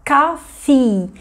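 "coffee" com as duas sílabas separadas e i final longo; som de coffee. Shown as a demonstration. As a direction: demonstre "coffee" alongando o i do fim. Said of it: "O som da palavra". ("coffee" com o i final bem marcado)

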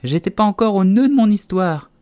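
high, loud indignant retort; heated, high-pitched complaint; furious. A narrator's reading. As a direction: rage as the offended mouse. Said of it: "s'exclama la souris d'un ton furieux".

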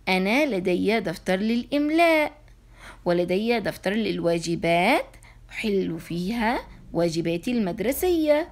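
ordinary delivery

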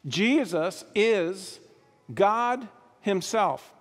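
"Jesus is God himself" said slowly, word by word; solemn declaration, slowed; slow emphasis. Acted. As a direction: land the point with weight. Declaring the sermon point.

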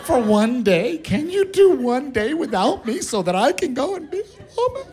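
rising high, pleading wife voice; high hammed-up plea. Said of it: "she says".

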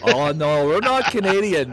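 loud, high North Dakotan protest; North Dakota accent. Retold or imitated. Mimicking North Dakotans denying they're Canadian, loud accented protest. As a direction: imitate indignant North Dakotans with rounded vowels. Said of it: "talking to people in North Dakota".

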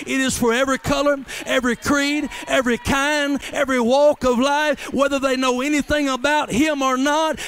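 loud, fast preacher's cry; full-voiced sermon shout; preaching at full force. Preaching climax listing everyone.

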